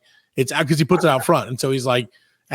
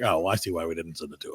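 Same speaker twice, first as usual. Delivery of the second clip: sheepish boss; 11 semitones lower, 13 dB quieter, faster than usual. Retold or imitated.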